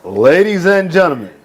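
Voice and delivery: drawn-out ring announcer call, building to a huge finish; ring announcer intro. Shown as a demonstration. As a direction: hype the crowd like a ring announcer building to the main event. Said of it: "What's, what is it?".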